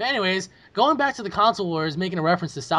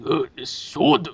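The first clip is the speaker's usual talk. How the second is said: groaning Sony guy; much slower than usual. Retold or imitated. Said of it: "he was like".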